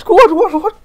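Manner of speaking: screaming in disbelief, high and loud; shocked shout. Real, not acted. Streamer shrieks at a surprise gacha pull, shocked shout. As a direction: yell out at the rare pull. Still reacting to rare pull.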